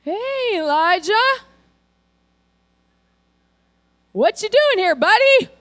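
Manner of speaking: friendly God. Retold or imitated. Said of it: "God ... he says".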